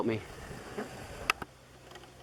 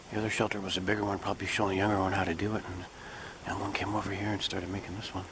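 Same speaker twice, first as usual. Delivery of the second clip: usual speaking voice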